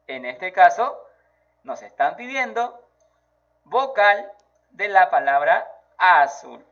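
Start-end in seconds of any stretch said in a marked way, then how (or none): none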